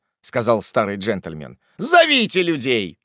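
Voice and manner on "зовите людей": high, raised-voice command; urgent, angry call; calling for help. Acted. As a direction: shout an urgent order. Master shouts for help.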